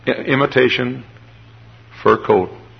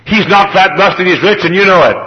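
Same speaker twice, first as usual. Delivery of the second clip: bossy little princess; 9 semitones higher, 7 dB louder than usual. Retold or imitated. Indignant girl scolding pastor.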